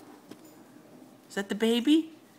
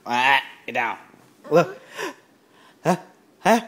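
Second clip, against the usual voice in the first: normal talking voice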